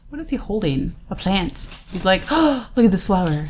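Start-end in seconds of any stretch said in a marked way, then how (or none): 2.3-3.4 lightly acted shocked gasp; mock shock. Voicing a shocked-looking card character, playfully mock-shocked. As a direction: pretend to be startled by a small find. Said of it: "why he's so shocked".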